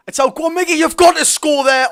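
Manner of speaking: high, loud and slowed, begging him to score; rising shout as the chance opens; excited urging. Real, not acted. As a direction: yell at the striker to finish it.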